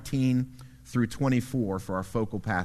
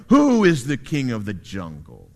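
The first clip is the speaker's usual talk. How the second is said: booming lion voice, louder and lower; lion roar; 3 semitones lower, 6 dB louder than usual. Acted. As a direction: roar the challenge at the zebra. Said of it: "he roars to the zebra".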